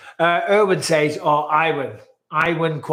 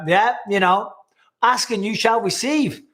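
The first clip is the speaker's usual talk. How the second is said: higher-pitched Northern Irish accent; Northern Ireland accent; 6 semitones higher than usual. Shown as a demonstration. Host granting a viewer's wish, in a Northern Ireland accent. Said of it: "There's your Northern Ireland accent".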